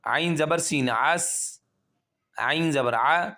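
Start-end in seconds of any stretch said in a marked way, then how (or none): none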